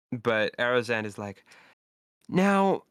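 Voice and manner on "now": high, louder character voice. Acted. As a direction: pitch it well up for the character. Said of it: "I haven't done this voice in a while".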